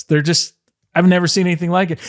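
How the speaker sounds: usual speaking voice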